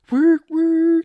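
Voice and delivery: high, loud toot-toot; train whistle imitation. Steam-powered car's little train thing, high loud whistle toots. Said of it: "does, like, a little train thing".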